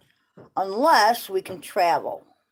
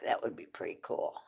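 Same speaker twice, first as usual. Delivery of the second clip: whispered; 11 semitones lower, 12 dB quieter than usual. Real, not acted. Hopeful aside about cheesecake.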